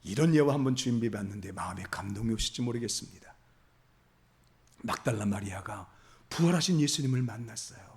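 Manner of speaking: whisper. Real, not acted. Pastor leads into an illustration.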